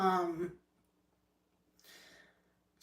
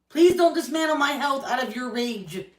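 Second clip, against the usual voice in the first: higher, louder plea hammering please; angry plea; 5 semitones higher, 6 dB louder than usual. Real, not acted. To Corey, begging him to stop his rage, loud plea. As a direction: beg loudly, every word pressed.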